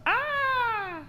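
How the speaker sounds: high, soft demo scream; small high-pitched mock scream; imitated movie scream. Shown as a demonstration. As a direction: sketch a film scream, held back. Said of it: "like, laugh, the".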